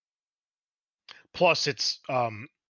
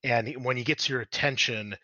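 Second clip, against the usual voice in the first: usual speaking voice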